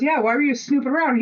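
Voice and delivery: high, teasing accusation; accusing friend. Retold or imitated. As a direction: tease him, high. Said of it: "she goes".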